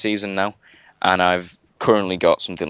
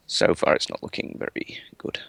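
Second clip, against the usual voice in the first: whispered, under the breath; whispered aside; 3 semitones lower, 5 dB quieter than usual. Real, not acted. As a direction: whisper it to yourself. Muttered aside while servers fail.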